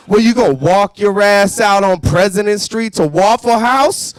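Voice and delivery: loud, menacing cashier; gangster cashier. Pretend. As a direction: threaten the customer. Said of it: "He's like".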